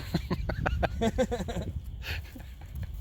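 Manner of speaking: an unvoiced burst of laughter; breathy laugh. Real, not acted. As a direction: laugh it off, amused at yourself. Laughing at own remark.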